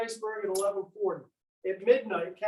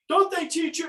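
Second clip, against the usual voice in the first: stern rebuke; 9 semitones higher, 5 dB louder than usual. Retold or imitated. Prim captain woken by officer bursting in.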